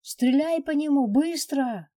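high, loud battle yell; urgent shout. Acted.

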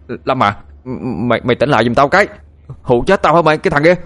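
stammering start building to a high, loud yell; shouting. A narrator's reading. Phương begging sleepwalking Lâm to wake, shouting. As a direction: yell up at a friend in panic, rising. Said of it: "thằng Phương hét lên".

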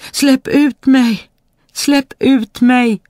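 high, loud, drawn-out cry; trapped spirit shouting. A narrator's reading. Spirit corked in the bottle again, high drawn-out shouting. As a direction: shout slowly and high to be let out. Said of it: "anden ropade igen".

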